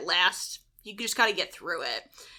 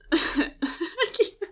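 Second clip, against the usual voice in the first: high giggle; 7 semitones higher than usual. Real, not acted. Laughing fit continues.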